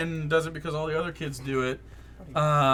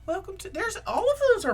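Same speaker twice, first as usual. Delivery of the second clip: friendly diner hostess; faster than usual. Acted. Middle-aged hostess welcoming diners, high voice. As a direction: lilt it high to customers. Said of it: "She goes".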